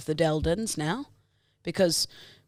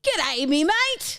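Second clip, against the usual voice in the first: slow, high Australian shout; Australian accent; 8 semitones higher, slower than usual. Acted. Friend asks for Australian, she shouts a slow Aussie greeting. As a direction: stretch out the Aussie g'day.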